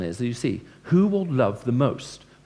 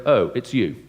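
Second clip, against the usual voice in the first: unwelcoming host; 5 dB louder, slower than usual. Shown as a demonstration. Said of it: "they look at you and they go".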